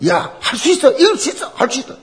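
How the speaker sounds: high-pitched rallying cry, retold; rally a losing team in a high voice; urging on teammates. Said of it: "팀을 막 독려했습니다". (동료들을 독려하는 높은 목소리)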